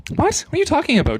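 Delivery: a sharp, loud cry of incredulity; incredulous what. Real, not acted. Reacting in disbelief to article claim.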